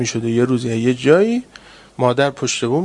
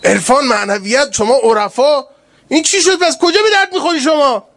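high, louder scolding taunt, in a put-on voice; sharp rebuke; 10 semitones higher, 4 dB louder than usual. Pilgrim scolding the mystic over the missed plane, high scornful rebuke. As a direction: scold him with mounting scorn. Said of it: "یه تشری اینجور زده بود".

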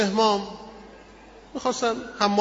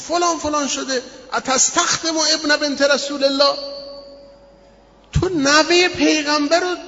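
retold angry shout; 8 semitones higher than usual. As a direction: berate him for letting the Imam serve. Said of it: "داد زد سر این سرباز".

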